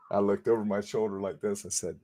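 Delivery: ordinary talk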